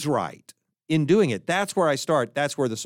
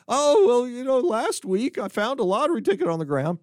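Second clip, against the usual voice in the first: weeping man; 8 semitones higher than usual. Acted. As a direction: wail out the lottery story.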